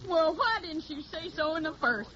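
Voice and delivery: put-on slow, drawling Tennessee country girl, Southern accent; put-on hillbilly accent. Hildy poses as a Tennessee country girl, in a put-on Southern accent. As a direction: play the naive Southern hillbilly singer. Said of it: "I got the technique down pat".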